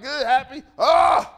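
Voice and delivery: cheerful words into a sudden yell; abrupt mock mood swing, very loud at the end; zero-to-sixty moody. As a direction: flip from happy to an explosive outburst. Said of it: "I'm like zero to sixty moody".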